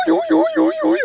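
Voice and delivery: high squeaky chicken noise imitation; chicken squeaking. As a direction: squeak it like a squeezed rubber chicken. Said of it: "My friend gets in my car and goes".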